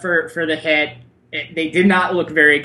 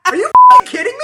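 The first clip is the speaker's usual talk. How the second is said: fast, high, loud disbelief; outraged yell; 8 dB louder, much faster than usual. Real, not acted. Outrage at betting line.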